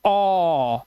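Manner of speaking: ओ held long as "awww"; drawn-out AW sound. Shown as a demonstration. Teacher isolating the vowel of hall, ओ as a long "aw". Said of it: "ओ की आवाज".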